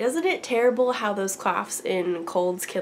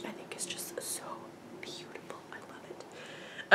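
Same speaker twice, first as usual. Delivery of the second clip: breathless whisper of delight over the yarn; whispered gushing; 12 dB quieter than usual. Real, not acted. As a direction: whisper it like a secret, in love.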